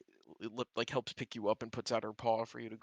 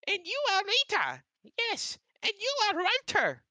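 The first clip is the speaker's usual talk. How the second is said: very high, loud chirpy greeter; excited owl; 8 dB louder than usual. Acted. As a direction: squeak it out like an eager little owl.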